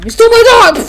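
high, loud angry yell; outraged mock accusation. Player voicing a dog owner confronting a thief, outraged shout.

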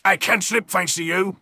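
ordinary talk, nothing marked

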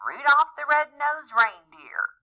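high, loud sing-song taunt; teasing. A narrator's reading. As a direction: taunt him like a bully. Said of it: "they teased".

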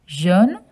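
jaune as zhohn, rising; French pronunciation. Shown as a demonstration. Asking children to repeat jaune. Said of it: "Can you say jaune?".

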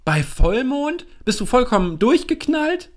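horrified high outburst; alarmed disbelief. Acted. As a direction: shriek it at your reckless friend. Willi alarmed, disbelieving friend.

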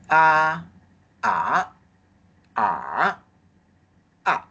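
a high like take-off, then rising, dipping and falling to landing; four tones. Shown as a demonstration. Teacher using the airplane image to drill the four tones. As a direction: trace the plane's flight with the tones on a. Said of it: "The aeroplane is running, take off".

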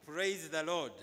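ordinary talk, nothing marked

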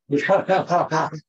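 short, loud burst of laughter; real laugh. Real, not acted. Speaker amused at own slip about friends through the ages, real laugh. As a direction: laugh out loud mid-thought.

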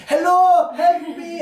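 high, loud hammed-up Indian accent cry for help; a soldier begging in an exaggerated Indian accent; mock Indian accent. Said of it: "with an Indian accent, dude's down asking for gun".

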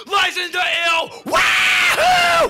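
loud very high whoop; mock rowdy cheer; crowd whooping. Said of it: "said they go like".

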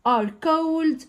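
slow textbook reading. Shown as a demonstration. Teacher reading the lesson text aloud to pupils, slow and clear. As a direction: read each English phrase out slowly for the class.

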